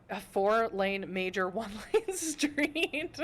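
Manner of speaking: slow, breaking up mid-sentence; real laughter through the words; laughing. Real, not acted. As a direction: try to say it, then dissolve into laughter. Laughs over a silly memory.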